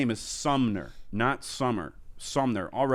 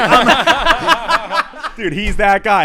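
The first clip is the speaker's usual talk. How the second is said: put-on drunk yell; 8 semitones higher, 12 dB louder, much slower than usual. Drunk Tom Brady voice.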